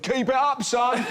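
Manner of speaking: cheeky mock-grown-up pat on the back; kid acting grown-up. Tiny ginger boy encouraging [name], high mock-adult voice. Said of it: "a tiny ginger boy went".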